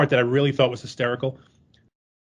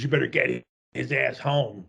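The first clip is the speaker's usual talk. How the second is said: ordinary talk, nothing marked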